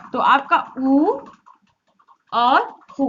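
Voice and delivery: slow, rounded-lip ऊ; vowel ऊ demo. Shown as a demonstration. Teacher shows pupils rounded-lip ऊ, slow. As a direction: round the lips and hold ऊ slowly. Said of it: "तो आप समझ गए कहाँ से होती है".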